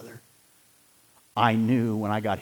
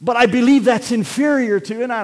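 emphatic preaching; 12 semitones higher, 9 dB louder than usual. Acted. Preaching at full force.

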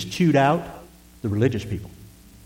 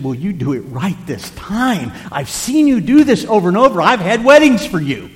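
retold plea swelling to loud, high exasperation; impassioned pleading; 8 semitones higher, 7 dB louder than usual. Pastor begging a much-married woman to change, loudly pleading. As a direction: start measured, then rise into loud urging. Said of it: "I pleaded with her".

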